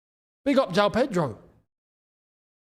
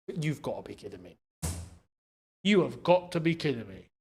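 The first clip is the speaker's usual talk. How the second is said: low, much softer groan of disbelief; deflated disbelief; 8 semitones lower, 7 dB quieter than usual. Real, not acted. Fan after his team concedes, low soft disbelief. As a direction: sink into it after the goal.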